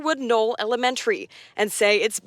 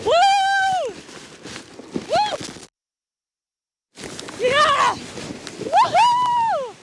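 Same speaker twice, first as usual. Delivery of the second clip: sledding downhill; much slower than usual. Real, not acted. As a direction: whoop your way down the hill. Reporter sledding down the hill.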